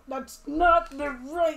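drawn-out, loud mimicry; mock objection. Player teasing Jason over the group's pick, mock objection.

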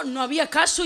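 normal talking voice

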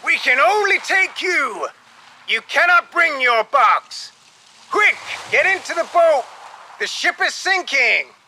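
high-pitched shouted orders; urgent shouting. A narrator's reading. Captain urging passenger into the rowboat, shouting. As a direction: shout with time running out. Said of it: "shouted the captain".

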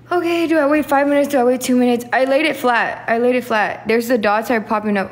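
loud, frantic rush of questions; panicked. Real, not acted. Alone checking if she waits five minutes or two, loud panic. Panics as test dots appear.

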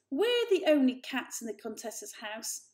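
loud, dismissive put-down; scornful older brother. A narrator's reading. Julius dismissing Varjak's story, loud and scornful. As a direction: talk down to him hard. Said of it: "snarled Julius".

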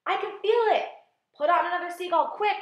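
high, loud, urgent cries; excited shouting. A narrator's reading. Crew feeling the peach lift, excited shouting. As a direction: shout urgently for one last push.

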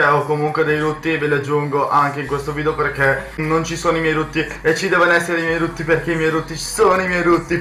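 usual speaking voice

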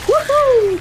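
a high, loud cheer; excited whoop. Real, not acted. Gamer cheering a win, high excited whoop. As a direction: whoop it out with joy.

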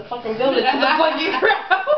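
real laughter breaking into the words; laughing. Real, not acted. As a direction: crack up as the sentence ends. Cracks up while blaming Doug.

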